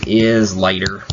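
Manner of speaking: normal talking voice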